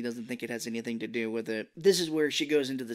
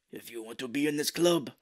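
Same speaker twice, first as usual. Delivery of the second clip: hammed-up bad Japanese accent, getting louder; fake Japanese accent; 2 semitones higher, 5 dB louder than usual. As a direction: imitate a henchman forcing a Japanese accent. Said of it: "why their Japanese is so bad".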